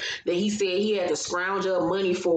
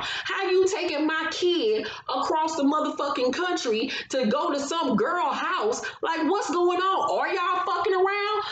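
outraged mother; 7 semitones higher than usual. Retold or imitated.

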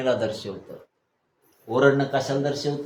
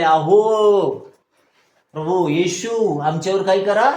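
loud plea, louder and higher, drawn-out Lord Jesus; crying out for mercy; 6 semitones higher, 6 dB louder, slower than usual. Acted. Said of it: "ते ओरडून म्हणाले".

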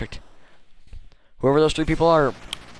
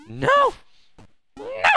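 high, loud panicked no; desperate cry; 4 dB louder than usual. Real, not acted. Gamer crying out as the character falls, panicked shout. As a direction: cry out as you fall.

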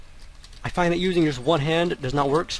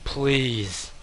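ordinary delivery

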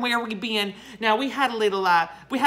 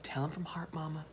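hushed aside prompt; whispering; 4 semitones lower, 11 dB quieter than usual. Real, not acted. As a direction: whisper the cue to Mama. Prompting Mama quietly, aside.

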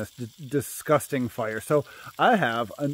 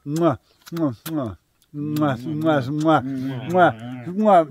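chef's kiss smacks; much slower than usual. Real, not acted. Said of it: "we're all mwah".

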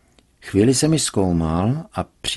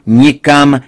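loud, higher refusal again; forbidding shout; 6 semitones higher, 10 dB louder than usual. A narrator's reading. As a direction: keep shouting it down. Father forbids India trip.

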